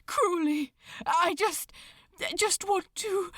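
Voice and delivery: words breaking off on held-back tears; tearful. Acted. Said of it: "eyes red and shining with unshed tears".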